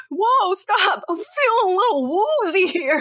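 high, whiny mock plea; hammed-up stabbing victim going faint; woozy wounded killer. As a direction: imitate a wounded goofball in a high voice. Acting out stabbed Stu.

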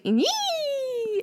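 very high robot squeal; Wall-E yay. Retold or imitated. Vlogger imitating the robot cheering after a five-star review, very high. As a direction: squeak it like a tiny happy robot. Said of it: "it sounds just like Wall-E".

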